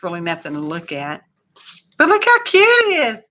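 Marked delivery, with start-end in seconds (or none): 2.0-3.2 high, loud squeal of delight; gushing. Real, not acted. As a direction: squeal over how cute it is. Gushing over a cute tag.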